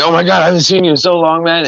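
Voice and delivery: high, quick pretend greeting; fake excited reunion. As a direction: gush quickly as if reunited with a friend. Acts out fake greeting to strangers.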